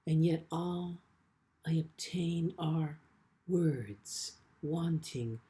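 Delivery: measured, deliberate poem reading with long pauses; poetry reading. Acted. Poet reads a poem about his father to the audience, measured and unhurried. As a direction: let each image land slowly, word by word.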